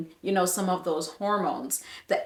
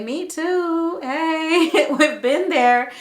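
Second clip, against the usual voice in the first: high, playful call-out; cheery solidarity; 7 semitones higher, 5 dB louder, slower than usual. Acted. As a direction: sing out like raising a hand in agreement. Models viewers cheering in solidarity.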